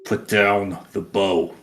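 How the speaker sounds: slow, controlled angry menace; slow, deliberate intimidation without raising the voice; understated anger, each word drawn out; angry threat. Acted. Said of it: "say in a very angry voice".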